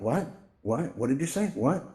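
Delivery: repeated questioning rises, acted confusion; straining to hear. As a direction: play someone who keeps missing the words. Struggling to hear people.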